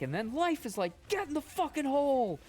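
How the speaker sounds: high, loud mock-furious yell; Orny Adams yelling. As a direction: slam it out like a raging comic. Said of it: "he's like".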